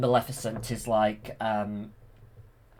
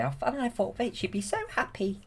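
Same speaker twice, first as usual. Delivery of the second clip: much higher, lightly voiced fairy; a puzzled fairy, pitched up; 12 semitones higher than usual. Fairies puzzled by Aurora's grief, in a higher voice. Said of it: "the fairies say".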